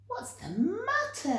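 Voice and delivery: high, concerned question; kind giant asking. Acted. Giant George asking the sad giraffe what's wrong, concerned. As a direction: ask gently what's wrong.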